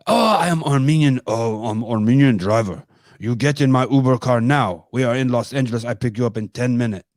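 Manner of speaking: playful Armenian driver accent, hammed up. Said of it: "my Armenian, old Armenian".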